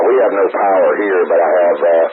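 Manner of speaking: ordinary talk, nothing marked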